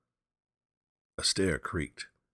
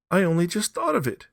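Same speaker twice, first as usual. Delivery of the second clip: high, loud, breathless rush; wife suddenly hit by an idea; hysterical; 11 dB louder than usual. A narrator's reading. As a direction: blurt it out near hysteria. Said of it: "she said hysterically".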